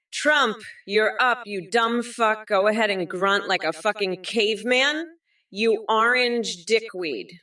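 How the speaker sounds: ordinary delivery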